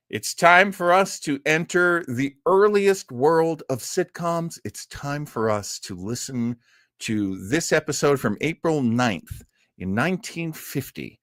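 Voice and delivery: ordinary talk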